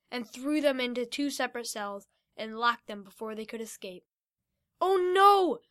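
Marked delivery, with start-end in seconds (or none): 4.8-5.6 dismay. Acted. Locked in cells.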